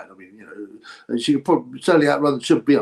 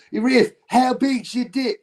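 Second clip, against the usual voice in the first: much higher, slow Australian jeering call; mock Aussie heckle; 12 semitones higher, slower than usual. Aussies at the footy heckling after a goal, in a mock Australian call. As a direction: yell it like an Aussie footy fan. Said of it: "they go".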